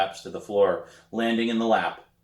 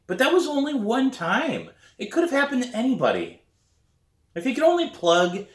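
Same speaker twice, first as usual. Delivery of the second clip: high defensive excuse, hurrying at the end; defensive whine; 4 dB louder than usual. A narrator's reading. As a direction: plead her case, pitched way up. Defensive inner protest.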